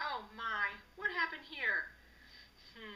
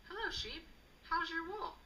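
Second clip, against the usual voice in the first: lightly voiced cow; 7 semitones higher than usual. Cow greets sheep, voiced.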